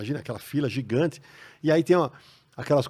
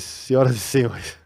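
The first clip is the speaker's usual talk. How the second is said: mock announcer; 4 dB louder, slower than usual. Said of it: "Aí eu fiz".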